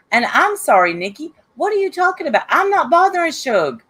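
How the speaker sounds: as if the accusation is absurd; exasperated disbelief. Real, not acted. Exasperated rebuttal to Nikki's accusation.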